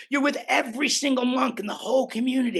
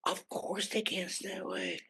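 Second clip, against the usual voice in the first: very soft, lower confidential murmur; hushed aside; 4 semitones lower, 14 dB quieter than usual. Real, not acted. Speaker confiding about sleepless monks, hushed. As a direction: say it way under the voice.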